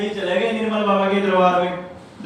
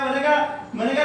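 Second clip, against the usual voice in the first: ordinary talk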